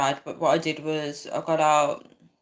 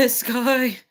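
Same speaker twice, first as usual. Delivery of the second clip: laughing; 8 semitones higher, 7 dB louder than usual. Real, not acted. Laughing at a viewer comment.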